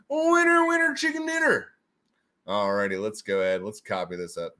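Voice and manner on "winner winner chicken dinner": high, bouncy chant; sing-song cheer. Real, not acted. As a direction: cheer the winners on. Celebrating winners, sing-song.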